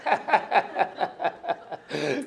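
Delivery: long, steady run of chuckles; amused laughter in quick bursts; real laugh. Real, not acted. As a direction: keep chuckling at your own joke. Laughs at his own joke.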